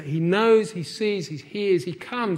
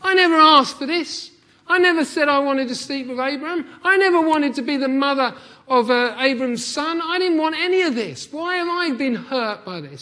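louder, higher, indignant protest with a laugh at the start; aggrieved complaint; 7 semitones higher, 6 dB louder than usual. Retold or imitated. Hagar protesting to God that she is innocent, loud and aggrieved. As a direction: plead your innocence, hurt and worked up. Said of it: "she could have said".

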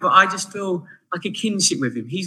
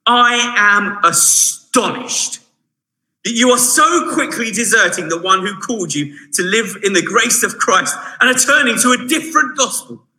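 astonished rebuke; 4 semitones higher, 4 dB louder than usual. Retold or imitated. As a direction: let the astonishment land on each word. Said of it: "His immediate thing is".

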